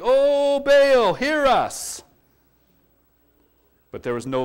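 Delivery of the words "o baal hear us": calling out. Retold or imitated. Prophets calling on Baal.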